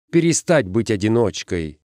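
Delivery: ordinary delivery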